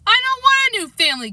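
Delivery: put-on boy yells back climbing the stairs; crying out. A narrator's reading. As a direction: cry it out in protest. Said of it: "Kevin cried".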